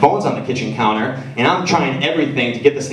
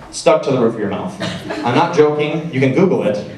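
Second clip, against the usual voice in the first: ordinary talk, nothing marked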